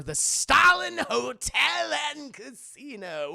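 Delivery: stretched-out hammed-up casino promo; mock announcer. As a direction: announce it like a grand opening. Mock advert for themed casino.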